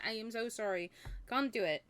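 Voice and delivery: plain reading voice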